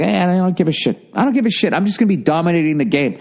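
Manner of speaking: high-pitched, carefree bragging; breezy, high mock-boast; cocky mock quote. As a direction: imitate a star shrugging it all off. Imagining the player's cocky reply.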